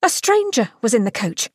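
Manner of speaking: plain reading voice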